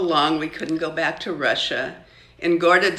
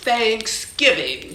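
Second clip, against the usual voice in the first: slow, higher, showing off the answer; smug announcement; 6 semitones higher, 4 dB louder, slower than usual. Acted. Elizabeth answering the teacher, smug slow announcement. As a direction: announce it like you knew all along. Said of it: "she announced".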